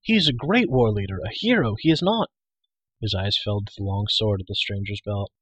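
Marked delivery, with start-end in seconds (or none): 0.0-2.3 high, loud, heated insistence; indignant young outburst; disbelieving cry. A narrator's reading. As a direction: cry out in protest, voice jumping up. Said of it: "he cried".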